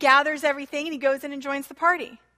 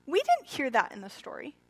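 normal talking voice

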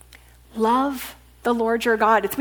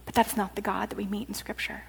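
hurried whisper, much softer; quick whisper; 3 semitones lower, 10 dB quieter, faster than usual. Acted. Preacher whispering to the congregation, fast. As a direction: rush it out under the breath. Sermon, rejecting the angry God.

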